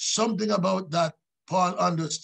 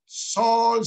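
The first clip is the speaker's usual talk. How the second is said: loud, held-out name call, pitched up; 4 semitones higher, 5 dB louder than usual. Acted. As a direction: call out as the voice from heaven. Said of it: "heard a voice saying unto him".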